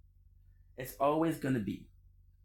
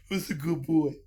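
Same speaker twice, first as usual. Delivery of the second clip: put-on baby talk to cat; 3 semitones higher, 4 dB louder than usual. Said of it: "I'll be like".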